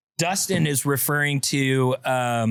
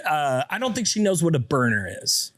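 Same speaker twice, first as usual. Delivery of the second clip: ordinary talk, nothing marked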